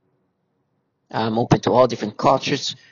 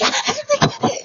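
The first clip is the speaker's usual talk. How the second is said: very high wordless imitation scream; shrill-pitched mock screaming. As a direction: imitate a woman's put-on shriek. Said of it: "She's supposed to be screaming".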